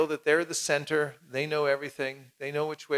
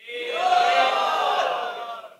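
huge high shout; shouted yeah; 4 dB louder than usual. Real, not acted. Audience answering are you ready, shouting. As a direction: cheer it out.

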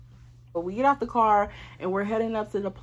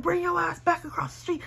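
retold barking order; 10 semitones higher than usual. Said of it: "literally screaming and barking".

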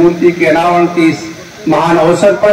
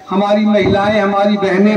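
usual speaking voice

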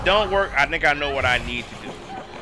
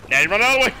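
exasperated shout; 10 semitones higher, 5 dB louder, much faster than usual. Real, not acted. Shouting at the game.

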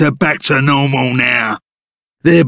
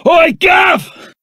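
high shout across the distance; calling out; 10 semitones higher than usual. Acted. Calling out to Gav that they caught one, high shout. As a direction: yell to get Gav's attention.

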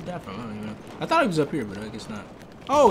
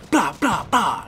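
mock gun noises; 9 semitones higher, 5 dB louder, much slower than usual.

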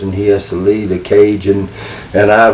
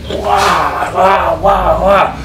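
high imitation gorilla bellows; repeated mock animal roars; wild gorilla roar; 9 semitones higher, much slower than usual. Manager shaking the cage, imitated gorilla roars. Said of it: "wild gorilla".

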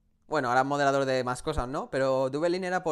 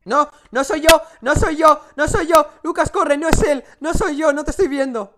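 high loud terrified shouting; panicked screaming; 11 semitones higher, 11 dB louder than usual. Real, not acted. Panicked in horror game.

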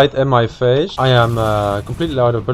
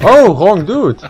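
surprised shout; 7 semitones higher, 6 dB louder, slower than usual. Real, not acted. Shot the wrong target.

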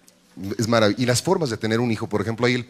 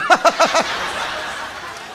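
mock cackle. Cackle after brag.

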